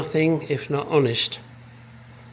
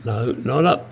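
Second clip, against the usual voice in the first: ordinary delivery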